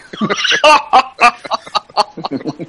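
high cackling villain laugh; evil laugh. Real, not acted. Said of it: "Qué risa malvada le ha salido a [name]".

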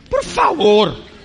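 a slow, disbelieving "please" with a laugh; scoffing. Real, not acted. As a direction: laugh it off as ridiculous. Scoffing at a rich singer's complaint.

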